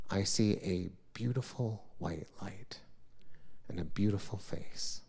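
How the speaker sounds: soft, hushed whisper; whispered awe. Real, not acted. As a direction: breathe it out in wonder. Waking up in the light.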